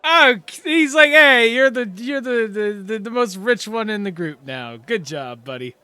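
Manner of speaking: high, loud jovial voice; hearty NPC voice. Acted. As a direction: clap him on the back, beaming. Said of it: "he's just like".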